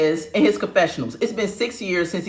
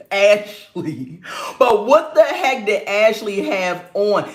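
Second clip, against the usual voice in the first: usual speaking voice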